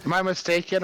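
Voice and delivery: normal talking voice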